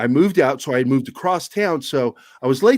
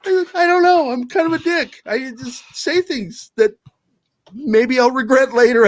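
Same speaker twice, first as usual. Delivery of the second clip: high sheepish shrug; 9 semitones higher than usual. Retold or imitated.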